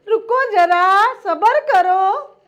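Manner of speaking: loud, drawn-out catchphrase impression; Hindustani Bhau. Imitating a viral video's call for patience, loud and slow. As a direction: hold them back with slow, booming words. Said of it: "બહુ આવે છે ને હિન્દુસ્તાની ભાવનો એ video".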